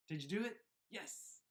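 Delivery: usual speaking voice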